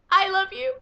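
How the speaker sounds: high, loud adoring shout; loud gush. Real, not acted. Fan shouting love at Aquaman, loud adoring cry. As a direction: yell your love out.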